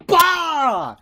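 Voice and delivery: long, loud, high impact cry; drawn-out explosive smack sound; punch impact noise. Acted. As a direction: blast out the knockout blow. Imitated second blow.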